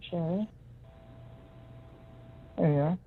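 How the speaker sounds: ordinary delivery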